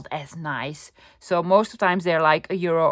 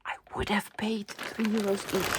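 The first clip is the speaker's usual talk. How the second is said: whisper; 8 dB quieter than usual. Real, not acted. Excited thrift haul secret price.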